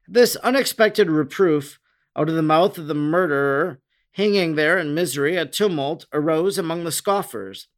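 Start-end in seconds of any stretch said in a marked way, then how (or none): none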